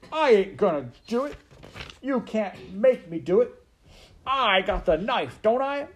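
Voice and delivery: loud, high-pitched refusal; defiant serving girl. Acted. Maid defying the rat with a knife, loud high defiance. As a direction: dig in like a stubborn simple girl.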